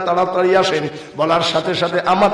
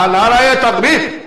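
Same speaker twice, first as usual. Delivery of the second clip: raised, loud call; rallying slogan shout; 5 semitones higher, 4 dB louder than usual. Real, not acted. Preacher leading the takbir, loud rallying shout. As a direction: shout it to lead the crowd.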